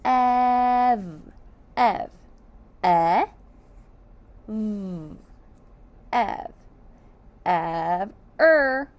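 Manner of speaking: Ev stretched and sounded E, V; teacher sounding out for kids; sounding out loud. Shown as a demonstration. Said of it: "Let's sound out ev".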